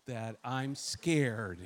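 low, soft mock-agreeing sarcasm; dry muttered sarcasm, dragging out scared; quiet sarcastic reply to God; sarcastic aside. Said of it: "I'm like".

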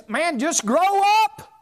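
loud exasperated scoff; exasperated outburst. Real, not acted. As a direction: snap at them to grow up.

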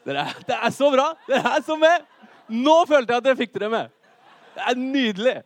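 loud, high, laughing praise; real delight. Real, not acted. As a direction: cheer the audience on with delight. Delighted that the audience joins in. (begeistret, høylytt ros)

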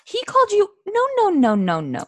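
outraged protest. Real, not acted. Protesting Claire's apology.

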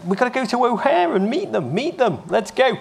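put-on high-pitched, excited kid pleading; excitedly insisting, drawing out the last words; eager urging. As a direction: urge them on, pitch leaping up. Kid urgently pleading to go meet team.

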